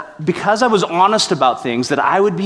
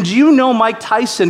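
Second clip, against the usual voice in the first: delighted retelling; 7 semitones higher than usual. Retold or imitated. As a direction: savour the absurd line. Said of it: "And his question".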